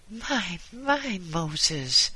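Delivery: high-pitched, dismayed exclamation; wife's high, startled reproach; exclaiming wife. A narrator's reading. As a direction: exclaim like a taken-aback wife. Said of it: "exclaimed his wife".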